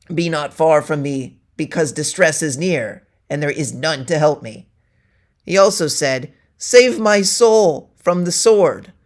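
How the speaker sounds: ordinary delivery, nothing marked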